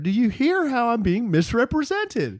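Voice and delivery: theatrical plea, pitched high; playfully aggrieved, high and declamatory; mock wronged appeal. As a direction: appeal to the audience as the injured party.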